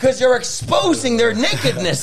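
fiery shouted preaching. Real, not acted. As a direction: preach it at full force, climbing high.

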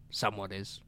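low, very quiet threat after a beat; deadpan menace. Acted. Killer answering men who challenge him, low deadpan. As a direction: drop it low and soft. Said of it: "dead silence for a second".

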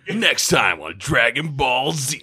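slow, drawn-out episode-preview voice; mock TV announcer. Co-host parodies a cartoon preview, slow announcer voice. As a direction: tease the next episode, hammed-up and slow.

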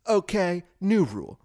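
announcing a new rule, mock TV host; lightly voiced Bill Maher decree; Bill Maher impression. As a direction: proclaim it like a smug late-night host. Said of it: "like that Bill Maher segment".

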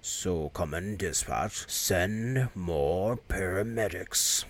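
quiet undead radio call; zombie voice. Retold or imitated. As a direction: drag it out like a dead man on a radio.

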